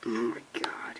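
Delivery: low breathy whisper of horror; horrified hushed whisper. Real, not acted. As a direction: barely breathe it out, aghast. Horrified reaction.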